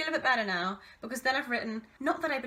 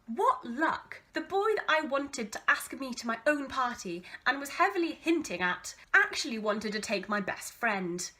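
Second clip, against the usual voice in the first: ordinary delivery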